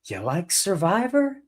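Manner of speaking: slow, high-pitched host imitation; imitated host's slow, coaxing pitch; Jeff Probst. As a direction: draw it out into a high, leading question. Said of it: "Jeff Probst is like".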